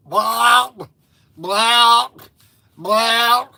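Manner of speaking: loud, rising "blah" noises; imitated noise, held and pushed; mock drunken blah. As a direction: blurt three loud drunken bleats. Mimes effect of four drinks.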